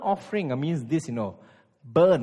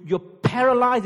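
higher and louder on paralyzed; emphatic outburst; 7 semitones higher, 7 dB louder than usual. Acted. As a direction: burst out with it. Preacher builds to climax.

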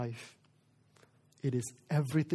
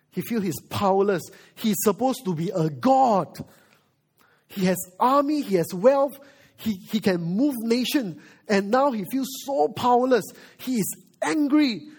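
raised, higher voice building in indignation; impassioned preaching; 6 semitones higher, 6 dB louder than usual. Acted. Preacher telling how powerless Pharaoh feels, impassioned. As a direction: preach Pharaoh's frustration loudly and forcefully.

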